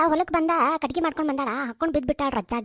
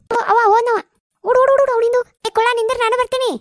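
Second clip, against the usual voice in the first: raised, higher-pitched calling; 6 semitones higher, 5 dB louder than usual. Acted. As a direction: call out urgently after someone running off. Calling after someone running off. (ಜೋರಾಗಿ ಕೂಗಿ ಕರೆಯುವುದು)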